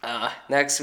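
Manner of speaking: normal talking voice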